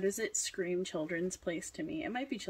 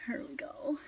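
whisper; 5 semitones higher than usual. Real, not acted. Hushed reveal.

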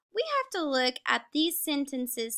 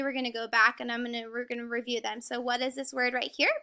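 ordinary delivery

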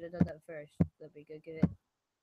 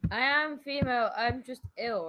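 pushed up into a higher pitch; 6 semitones higher, 7 dB louder than usual. Pretend. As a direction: raise the voice to sound feminine. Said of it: "I'm sorry if I sound male".

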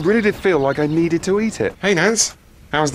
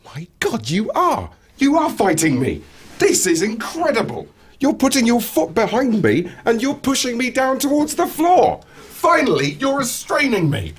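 delighted excitement; 11 semitones higher than usual. Acted. Jeremy thrilled Mark tackles him.

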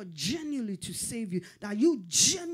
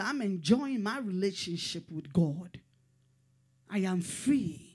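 usual speaking voice

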